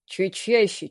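plain reading voice

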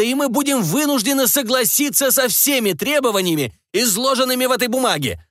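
high, furious shout at a minister; angry shouting. A narrator's reading. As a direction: scold him like a naughty boy. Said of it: "Он кричал на меня".

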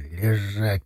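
low, soft hiss through the teeth. A narrator's reading. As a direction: hiss the command, teeth clenched. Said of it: "прошипел сквозь зубы".

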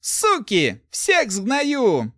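high, loud cursing threat; yelling. A narrator's reading. Tied-up thief cursing his teachers, yelling high. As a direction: yell threats at your tormentors. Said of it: "заорал Руди".

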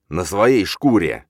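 slow, loud, heavy taunt; forceful, word by word. A narrator's reading. Father jolting the boy's throat with each word, menacing. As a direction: drive each word in like a shove. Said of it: "С каждым словом новый толчок".

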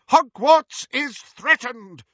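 slow, loud, high battle cry; rally the castle to arms; shouting. Acted. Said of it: "shouted Professor McGonagall".

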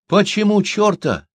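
high, loud, slow retort; loud mocking shout; crowd shouting back. A narrator's reading. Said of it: "закричали из толпы".

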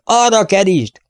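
high, long-carrying call; distant herding shout. A narrator's reading. Herder directing the chase from afar, calling out. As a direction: call out across the plateau. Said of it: "kiáltásokat".

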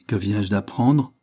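ordinary delivery, nothing marked

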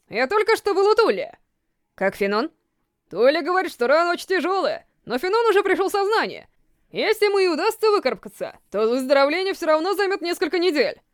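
lightly voiced old man. A narrator's reading. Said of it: "старческий голос". (голосом старика)

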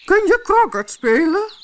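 high, loud, imperious queen's voice; a bossy queen snapping at servants; angry queen. Acted. Queen demands what the gardeners are doing, high, loud and imperious.

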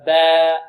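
با with a thin, fronted long a, held; drawn-out light با, no heaviness; light alif. Shown as a demonstration. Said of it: "وإذا كان ما قبله مرقق".

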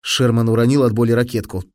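ordinary delivery, nothing marked

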